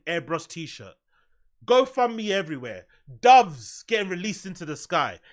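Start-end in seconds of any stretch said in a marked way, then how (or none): none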